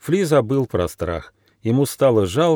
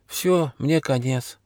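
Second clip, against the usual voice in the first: slow, resigned thought; higher and slow, as if all is lost; doomed; 4 semitones higher, 4 dB quieter, slower than usual. A narrator's reading. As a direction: think it aloud, hopelessly. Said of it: "обречённо подумал Фли".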